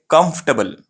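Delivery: "कंफर्टेबल" shortened to KUMF-tuh-bul; right pronunciation. Shown as a demonstration. Teacher tells what it is called, "कंफर्टेबल" as kumf-tuh-bul. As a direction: drop the "er", reduced ending in "कंफर्टेबल". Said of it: "ये right pronunciation है".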